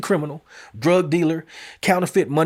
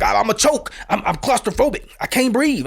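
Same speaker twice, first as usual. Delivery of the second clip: lightly mimicked high, stammering protest; higher imitated plea, stumbling starts; imitating agitated suspect; 6 semitones higher than usual. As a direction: imitate a suspect pleading, pitch raised. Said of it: "that's how he sounded".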